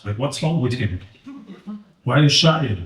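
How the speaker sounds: ordinary talk, nothing marked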